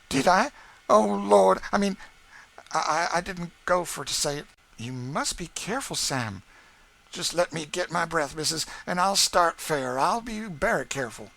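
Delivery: loud, fast comic panic; Sam flustered. A narrator's reading. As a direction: trip over your own words.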